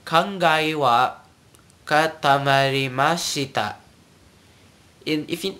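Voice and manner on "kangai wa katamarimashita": slow, separated "katamarimashita", every vowel sounded; said slowly. Shown as a demonstration. Teacher breaks the phrase down, slowly. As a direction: spell out "Kangai wa katamarimashita" slowly by ear. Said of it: "let me say it slowly".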